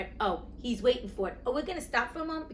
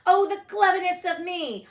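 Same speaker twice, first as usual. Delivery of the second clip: higher and louder, gloating with delight; crowing; 8 semitones higher, 8 dB louder than usual. A narrator's reading. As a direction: crow about your own brilliance. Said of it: "he crowed rapturously".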